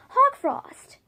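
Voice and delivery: a high, loud cry of the name; calling out. Acted. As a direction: shout his name across the battle. Calling out to a warrior.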